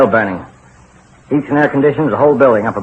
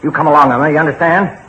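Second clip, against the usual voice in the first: higher, louder bossy command; stern order; 5 semitones higher, 3 dB louder than usual. Acted. Husband orders wife into the tunnel, stern and bossy. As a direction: insist she follows.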